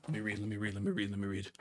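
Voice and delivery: very soft, low repeated mumble; muttering to himself. Real, not acted. Streamer to himself trying to read on, muttering. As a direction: say it under your breath, quickly refocusing.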